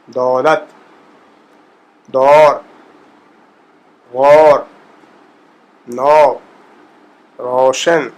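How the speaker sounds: دولت دور غور نو روشن with the ow diphthong of English sow; Persian diphthong. Shown as a demonstration. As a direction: diphthong word drill. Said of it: "آپ کہہ لیں ڈپتھونگ".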